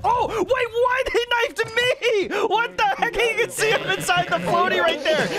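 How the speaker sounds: high-pitched, incredulous cry; shocked outburst. Real, not acted. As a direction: react in real shock at being caught out. Shocked at a sudden knife kill.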